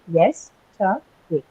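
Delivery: yesterday spoken slowly, each syllable apart. Shown as a demonstration. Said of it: "what would be those syllables?".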